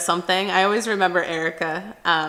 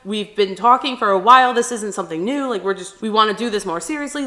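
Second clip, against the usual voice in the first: louder, pressing explanation; urgent pleading; 2 semitones higher, 7 dB louder than usual. Retold or imitated. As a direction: talk him down in a raised voice. Pulling brother off Brett.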